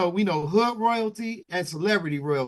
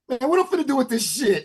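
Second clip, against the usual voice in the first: higher, louder mock dismissive sneer; 7 semitones higher, 5 dB louder than usual. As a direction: toss the question off like a brushed-off star. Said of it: "and [name] says".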